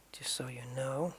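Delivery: conspiratorial whisper to the audience; whispered aside. Real, not acted. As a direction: lean in and whisper it. Aside to viewers.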